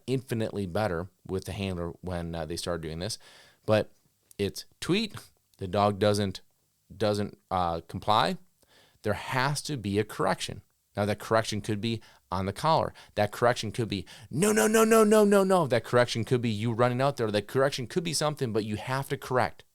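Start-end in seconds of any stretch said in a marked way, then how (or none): 4.4-5.1 whistle imitation. Shown as a demonstration. 14.3-15.7 high, loud repeated no; verbal correction. Shown as a demonstration.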